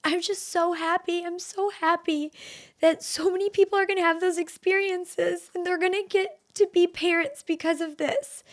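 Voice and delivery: high, tearful voice on the verge of tears; crying. Real, not acted. Grateful host fighting happy tears with listeners. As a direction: choke up with real happy tears. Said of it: "I'm literally gonna cry again".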